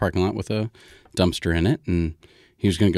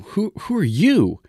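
startled; 12 semitones higher than usual. Acted. Paul confronts the stranger.

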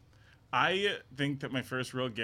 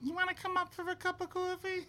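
put-on much higher, laughing baby voice; a baby hitting on a stranger; 3 dB quieter than usual. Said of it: "I would go".

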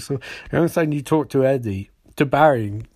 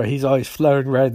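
ordinary talk

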